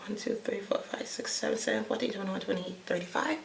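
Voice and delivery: soft murmured counting; counting under breath. Real, not acted. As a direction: mumble the count to yourself.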